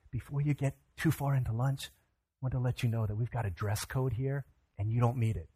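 hushed, confidential aside; discreet murmur. Retold or imitated. Owner quietly telling a guest about the dress code, hushed. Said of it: "he whispers in my ear".

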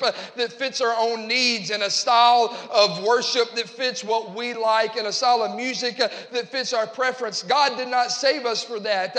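loud, emphatic pulpit declaration; forceful sermon cadence, building in volume; impassioned preaching. Real, not acted. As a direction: drive each phrase home to the congregation. Preaches to congregation.

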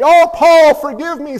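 put-on the old preacher catching his mistake; startled apology. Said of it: "says the old preacher from Wales".

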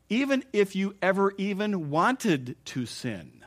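slow, stressed preaching on even wanted; emphatic point. Real, not acted. Preacher pressing the congregation, slow and emphatic. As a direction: lean into every word to land it.

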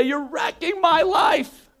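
put-on slow, drawn-out teenage yell; drawn-out mock yell from a resentful teenager; mock teen outburst. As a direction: shout it like a kid who feels wronged. Child yelling at parent.

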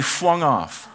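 "flung" said as "fwung"; childish mispronunciation. Retold or imitated. Punchline of grandson's fall, "flung" said as "fwung". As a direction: slowly turn the L of "flung" into W. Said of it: "flung means flung".

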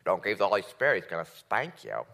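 mild mock-menacing threat. Shown as a demonstration. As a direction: pretend to warn of a spanking. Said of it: "isn't a threat".

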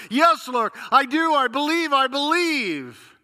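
high, loud, pleading yes; cling to the words, desperate to be believed; eager affirmation. Acted. Man eagerly affirms faith.

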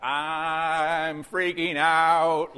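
loud, very drawn-out mock panic; mock freak-out. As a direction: stretch every word out loudly, hamming up the signing. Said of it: "now he's signing like".

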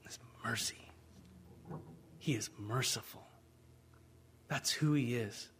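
hushed, breathy whisper; whispered mercy. Acted. Preacher whispering to the congregation about God's mercy, hushed. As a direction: lean in and whisper it. Hushed answer after question.

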